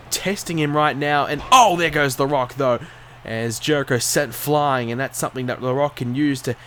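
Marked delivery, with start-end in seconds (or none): none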